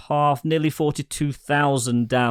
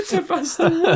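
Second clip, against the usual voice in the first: high laughing repeat. Real, not acted. Cohost repeats the joke number, laughing high. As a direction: squeal the line through laughter, much higher.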